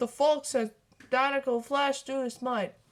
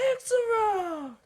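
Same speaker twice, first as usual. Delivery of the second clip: shout; 10 semitones higher, 4 dB louder, slower than usual. Acted. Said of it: "the car shouted".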